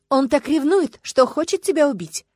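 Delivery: high-pitched jealous-drama heroine; melodramatic warning. A narrator's reading. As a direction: warn him urgently, TV-drama style. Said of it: "предупредила платиновая блондинка смазливого брюнета". (взволнованно, высоким женским голосом)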